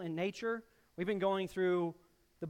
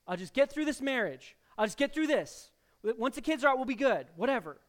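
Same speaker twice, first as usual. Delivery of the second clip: louder, higher insistent "I got this"; stubborn self-reliance; 5 semitones higher, 7 dB louder, faster than usual. Retold or imitated. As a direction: brush God off like the stubborn child. Voicing a stubborn self-reliant believer.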